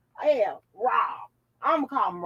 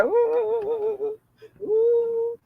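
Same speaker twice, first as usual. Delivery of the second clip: high pretend whimpering oohs; fake-cry in high little oohs, touched by the comments; playful pretend sob; mock crying; 12 semitones higher, much slower than usual. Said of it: "I'm about to cry".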